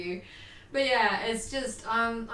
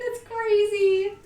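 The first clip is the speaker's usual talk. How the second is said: excited; 11 semitones higher, 6 dB louder, slower than usual. Real, not acted. Sing-song remark on time.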